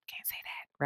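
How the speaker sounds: hushed "Can't say that"; conspiratorial whisper; whispered aside. Real, not acted. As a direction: whisper it like a secret. Censored aside.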